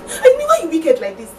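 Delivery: loud, heated confrontation; angry accusation. Acted. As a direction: confront a cheating lover, loudly. Woman confronts her cheating lover.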